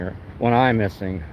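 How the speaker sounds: usual speaking voice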